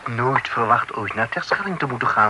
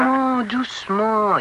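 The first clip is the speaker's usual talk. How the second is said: soothing "easy now"; 8 semitones higher than usual. Acted. Calming an impatient friend.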